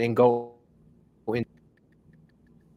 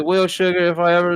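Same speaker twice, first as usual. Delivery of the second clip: a higher, sweet hint of a Southern lady; light Southern accent; 7 semitones higher than usual. Reading the AI's Southern woman line, a light Southern lilt. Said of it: "with a thick Southern accent".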